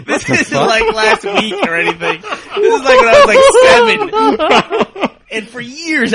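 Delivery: high, loud laughing speech; laughing hard. Real, not acted. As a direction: talk while cracking up.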